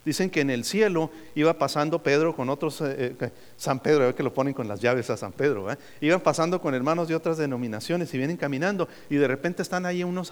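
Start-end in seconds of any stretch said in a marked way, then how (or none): none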